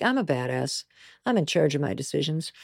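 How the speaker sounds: low, quiet mock-badass voice; cool defiance. College smoker defying the world, cool defiance. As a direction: play it low and self-assured. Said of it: "kinda like a giant F you to the world".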